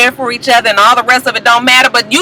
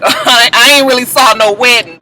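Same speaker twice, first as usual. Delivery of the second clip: normal talking voice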